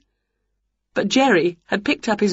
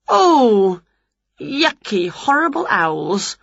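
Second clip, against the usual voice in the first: disgusted; 3 semitones higher, much slower than usual. A narrator's reading. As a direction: recoil in disgust at the mess. Disgust at being splatted.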